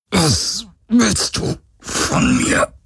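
strained, high and slow; through clenched teeth. A narrator's reading. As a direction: force each word out through gritted teeth. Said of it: "presste er zwischen zusammengebissenen Zähnen hervor". (mühsam zwischen zusammengebissenen Zähnen gepresst)

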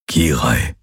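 low-pitched, tender saying of her name; touched. A narrator's reading. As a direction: say the name moved, dropping low. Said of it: "Es klang gerührt".

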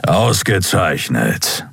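slow, drawn-out captain's rumble; slow growly satisfaction; grumbled approval. A narrator's reading. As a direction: rumble it slowly, savouring the word. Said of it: "brummte Kapitän Thron".

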